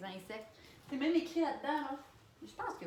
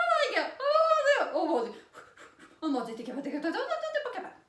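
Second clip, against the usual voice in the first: high, loud frantic "oh mon Dieu"; panicked self-talk; 11 semitones higher, 10 dB louder, faster than usual. Retold or imitated. Telling herself in panic she can do it.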